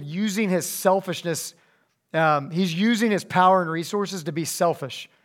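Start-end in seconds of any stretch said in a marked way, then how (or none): none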